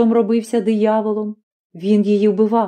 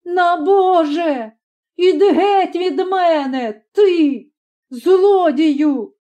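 much higher, slow, furious mother; furious shout; 8 semitones higher, much slower than usual. A narrator's reading. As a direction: drive the son away with a scream. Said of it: "закричала вона". (матір жене сина, вищим криком)

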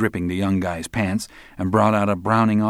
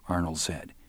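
usual speaking voice

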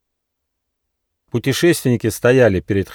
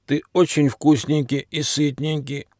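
put-on raised-pitch old dog voice with coughs; old hungry stray dog; 5 semitones higher than usual. A narrator's reading. Old stray dog to tasty platypus, hungry coughing complaint. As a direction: complain and hunger, coughing between phrases.

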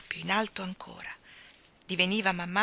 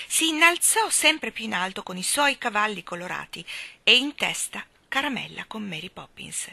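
ordinary delivery, nothing marked

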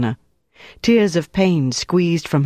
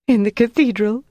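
high, tearful woman's voice; sobbed answer; 8 semitones higher than usual. A narrator's reading. Prisoner breaking under interrogation, sobbed answer. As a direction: give in through acted sobs, pitched high. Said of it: "she sobbed".

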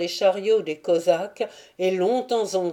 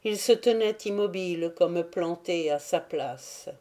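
plain reading voice

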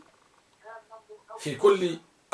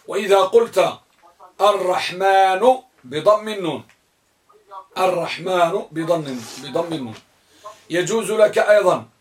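ordinary delivery

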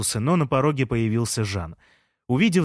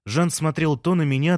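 ordinary delivery, nothing marked

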